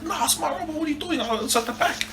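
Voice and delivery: put-on high, thin-volume kid's protest; squabbling child. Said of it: "me and my sister were fighting over a rubber".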